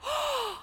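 sharp high gasp of alarm; acted horrified intake of breath. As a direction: gasp in mock shock. Acts out reaction to crying son.

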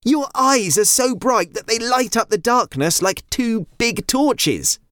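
put-on high, bright girl's voice; thrilled child discovering something; excited young girl. Jada speaks excitedly.

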